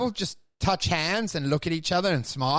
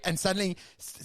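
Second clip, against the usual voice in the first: ordinary talk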